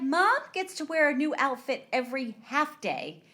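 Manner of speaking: high, building mock-angry complaint; pissed-off rant. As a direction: rail indignantly, peaking loud on the end. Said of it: "Kathy's so pissed".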